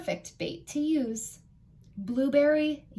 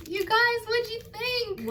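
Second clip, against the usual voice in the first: excited; 10 semitones higher than usual. Real, not acted. Excited after finishing story.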